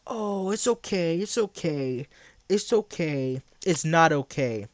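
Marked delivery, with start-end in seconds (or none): 0.1-3.4 slow, cooing mock comfort; mock soothing. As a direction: rock him to sleep sarcastically. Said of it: "you need to be cradled".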